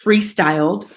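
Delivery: ordinary delivery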